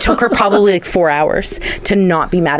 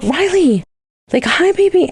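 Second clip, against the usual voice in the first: put-on much higher, sweet baby talk; baby talk to dog; 13 semitones higher than usual. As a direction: coo at the dog. Greeting her dog at the vet.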